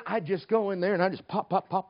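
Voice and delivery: ordinary delivery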